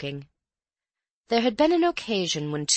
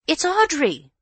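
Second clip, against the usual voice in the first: high, raised voice of a housemaid; high announcing call; 12 semitones higher, 6 dB louder than usual. A narrator's reading. Elsie telling the room who has come, high call.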